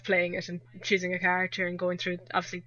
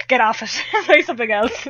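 louder, playful telling-off; mock nagging; 3 semitones higher, 8 dB louder than usual. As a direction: imitate a fed-up friend, breaking into a laugh. Imitating friend nagging her off game.

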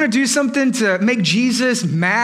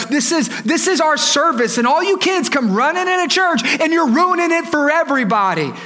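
high-pitched scolding complaint; exasperated disciple; 5 semitones higher than usual. Acted. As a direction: build to a fed-up rant at the kids. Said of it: "They were like".

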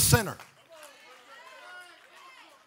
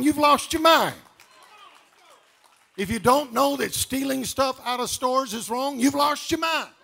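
rising, louder preacher cadence building to a shout; fiery sermon climax; preaching at full force; 8 dB louder than usual. Acted. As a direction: hammer each command home to the church.

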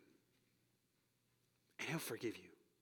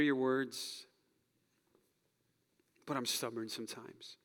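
earnest closing prayer. Real, not acted. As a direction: pray it sincerely for the room. Pastor leads closing prayer.

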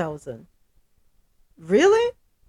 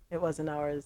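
softer, lower aside; muttered to herself; quiet rueful repeat; 6 semitones lower, 12 dB quieter than usual. Real, not acted. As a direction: drop the voice, a little rueful.

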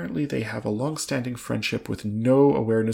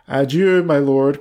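loud, raised, showy farewell; cried out; 4 semitones higher, 9 dB louder, slower than usual. A narrator's reading. Mister Saltina matching the Earl's French goodbye, crying out. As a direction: cry it out, not to be outdone. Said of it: "cried Mister Saltina, not to be outdone".

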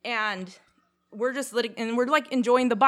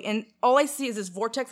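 ordinary talk, nothing marked

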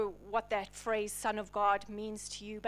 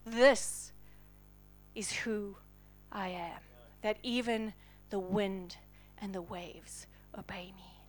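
soft, lower whisper fading away; hushed revelation; 6 semitones lower, 8 dB quieter than usual. Acted. Jesus showing the disciples who he is, fading whisper. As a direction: let the words fall to a whisper. Jesus reveals himself quietly.

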